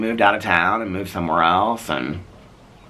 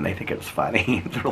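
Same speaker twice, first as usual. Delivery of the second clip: ordinary talk, nothing marked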